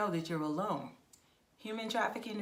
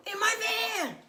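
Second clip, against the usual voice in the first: high, loud mock kidnapper; 5 dB louder than usual. Mock trafficker reveal.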